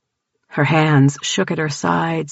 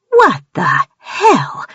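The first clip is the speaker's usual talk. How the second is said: high, slow shocked cry; 13 semitones higher, 3 dB louder, much slower than usual. A narrator's reading. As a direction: recoil in disbelief.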